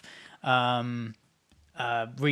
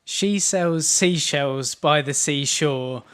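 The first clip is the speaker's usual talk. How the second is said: slow, halting tongue twister under a speech jammer; slow, stumbling tongue twister; 2 semitones higher, 8 dB louder, much slower than usual. Shown as a demonstration. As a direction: stumble slowly through each word. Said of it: "it's so annoying".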